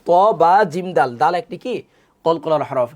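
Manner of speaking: normal talking voice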